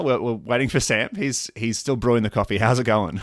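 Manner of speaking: podcast host intro. Acted. Reads the interview intro.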